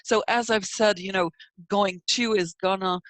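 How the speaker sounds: normal talking voice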